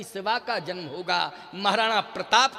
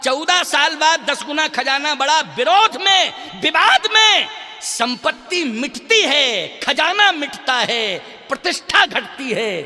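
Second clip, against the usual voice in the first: loud, slow, emphatic proclamation; full-force preaching; 5 semitones higher, 8 dB louder than usual. Real, not acted. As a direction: hammer each phrase home to the crowd. (पूरे ज़ोर से प्रवचन)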